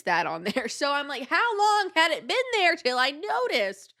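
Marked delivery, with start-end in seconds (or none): 1.3-3.9 high, slow, put-on horrified voice; high, drawn-out gasp of disgust; horrified wondering. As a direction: act out the shock, stretched and high. Horrified at a moldy sponge.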